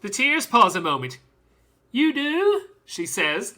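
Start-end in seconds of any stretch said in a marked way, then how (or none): 1.9-2.7 put-on high questioning child voice; curious little girl. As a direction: lift it high, hopeful and curious. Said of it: "looking curiously at me".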